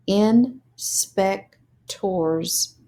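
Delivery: "Inspectors" blended very slowly; slow blended word. Shown as a demonstration. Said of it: "put it together as words".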